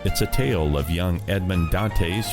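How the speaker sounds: ordinary talk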